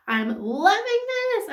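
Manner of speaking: high, gushing delight over a lipstick; excited gushing. Real, not acted. Beauty vlogger gushing over her new lip kit, excited. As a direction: squeal it out, thrilled by the colour. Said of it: "I get so excited about it".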